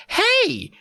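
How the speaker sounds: very high mock scream; hammed-up mom's outburst. A narrator's reading. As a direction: shriek it in falsetto without much volume. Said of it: "Mom screamed".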